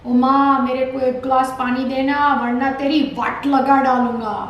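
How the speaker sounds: loud, level Mumbai street-tough slang; Mumbai mawali. Shown as a demonstration. Mumbai street tough demanding water from Mom, mawali accent. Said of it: "if they were total Mumbai, Mawali kind".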